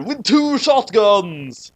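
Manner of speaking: usual speaking voice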